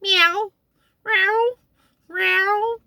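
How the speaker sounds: imitating a cat asking for cream; cat meow. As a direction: meow up high, rising at the end. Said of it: "It first started out as a meow".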